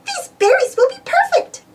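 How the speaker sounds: high, sweet girlish cartoon mouse voice; bright chirpy mouse voice; Minnie voice. Minnie tells Mickey the berries will do, chirpy high voice. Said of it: "said Minnie".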